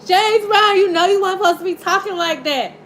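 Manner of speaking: high, loud playful scolding of the singer; excited teasing. Real, not acted. Tarot reader teasing [name], excited and amused. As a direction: tease him in delight.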